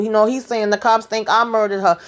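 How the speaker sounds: usual speaking voice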